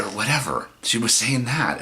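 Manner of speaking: plain reading voice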